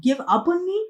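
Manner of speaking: a high, outraged cry; roaring Luke. Acted. Luke outraged at Percy's message, a high, hurt cry. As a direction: throw the question back in open outrage. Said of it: "Luke roared".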